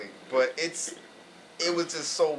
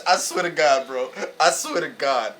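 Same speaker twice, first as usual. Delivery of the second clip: ordinary delivery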